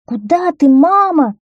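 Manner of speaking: high, loud plaintive call; high child's voice, rising in volume; children crying out. A narrator's reading. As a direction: cry after a departing mother. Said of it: "закричали дети в один голос".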